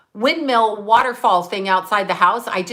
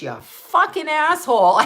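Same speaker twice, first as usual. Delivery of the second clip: raised-pitch, comic indignation; mock outrage; 5 semitones higher than usual. Patient waking up to curse at husband, mock outraged. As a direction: retell the curse as a playful outburst.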